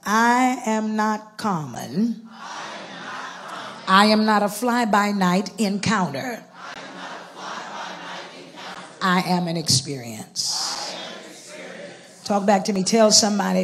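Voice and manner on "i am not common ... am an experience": measured call-and-response declaration for the congregation; slow proclamation. Shown as a demonstration. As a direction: proclaim it slowly, leaving room for them to echo. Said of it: "to just shout this out".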